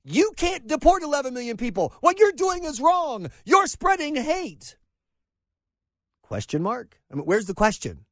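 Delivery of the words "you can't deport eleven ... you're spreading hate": mock indignant, high-pitched accusation; Jorge Ramos. Reporter's so-called question to the candidate, mock outraged. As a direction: imitate a protesting reporter, stretching the final words. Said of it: "his question is".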